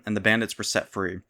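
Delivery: usual speaking voice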